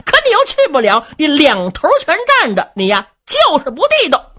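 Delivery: high, loud, fast heated scolding; fuming brother accusing Luo Cheng of sitting on the fence; angry tirade. A narrator's reading. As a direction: rattle off the accusation in rising fury. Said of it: "双眉倒竖， 二目圆睁".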